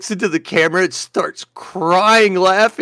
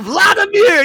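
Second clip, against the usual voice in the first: high-pitched holler of a name; shouted name; 4 dB louder than usual. Retold or imitated. Downed pilot answers his enemy, hollering. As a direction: call your name across the sky. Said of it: "He says".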